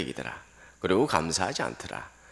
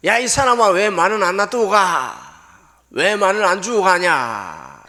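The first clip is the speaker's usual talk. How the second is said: furious shout; 12 semitones higher, 11 dB louder than usual. Retold or imitated. Hot-tempered villager yelling at the passing giver, furious shout. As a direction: bellow it much higher and louder. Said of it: "대뜸 고함을 지릅니다".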